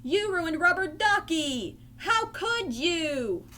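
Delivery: high-pitched outraged accusation; angry scream. Acted. Ernie blames the kitten for his ruined duck, angrily crying out. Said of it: "he screamed".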